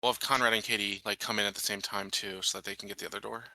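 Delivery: ordinary talk, nothing marked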